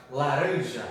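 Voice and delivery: laranja drawn out, stress on RAN; stress on middle syllable. Shown as a demonstration. Teacher gives students an example word, stressed on RAN. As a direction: say laranja slowly, leaning on the ran. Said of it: "onde você dá ênfase na hora de falar".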